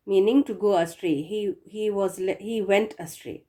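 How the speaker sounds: ordinary talk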